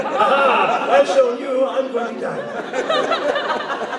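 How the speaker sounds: high, laughing hammed-up triumph; gleeful mock death. Voice actor replays a botched stabbing reaction, laughing high. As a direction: crow it happily while supposedly dying. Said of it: "I was actually being stabbed".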